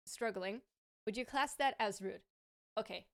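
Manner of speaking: normal talking voice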